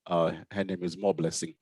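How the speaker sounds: ordinary talk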